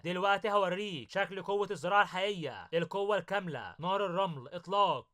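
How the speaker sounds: plain reading voice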